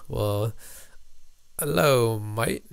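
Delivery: a quick playful Australian greeting; Australian accent, lightly imitated; mock Australian accent. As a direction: toss off a jokey Australian hello, mate. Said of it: "No, that's Australia".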